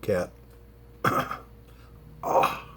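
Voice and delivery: ordinary talk, nothing marked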